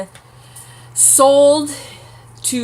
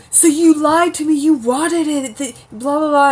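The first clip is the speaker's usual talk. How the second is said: higher, louder hammed-up outrage; angry accusation; 6 semitones higher, 6 dB louder than usual. Jamie accusing Brianna after she explains: mock angry outburst. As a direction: throw the accusation back at her. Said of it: "And he's like".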